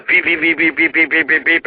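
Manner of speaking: quick, even run of beeps; imitated crossing signal. Storyteller mimicking the crossing signal for blind people, rapid beeping. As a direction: mimic a repeating electronic beep. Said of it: "it was for blind people".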